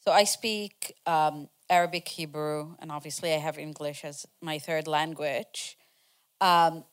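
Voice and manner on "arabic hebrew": ordinary talk, nothing marked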